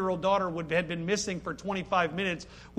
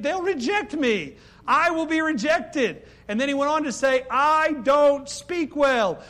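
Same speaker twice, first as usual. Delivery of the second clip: whiny excuses; 6 semitones higher, 5 dB louder than usual. Acted. Preacher voicing Moses' excuses to God, whining. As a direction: plead your way out of it.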